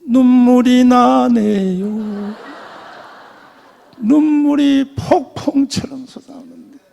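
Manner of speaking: loud, long-drawn mock wail; hammed-up slow, plaintive cry; tearful lament. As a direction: stretch the words out as if bawling. Acts tears pouring on way home.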